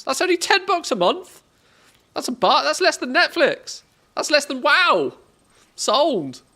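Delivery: high, loud burst of amazed excitement; excited disbelief. Real, not acted. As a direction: blurt it out, thrilled at the bargain. Excited at a cheap price.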